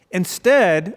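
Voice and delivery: normal talking voice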